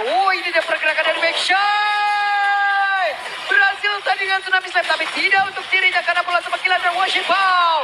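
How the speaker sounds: loud excited play-by-play; esports caster. Acted. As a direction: scream the triple kill call. Hyped play-by-play of kills.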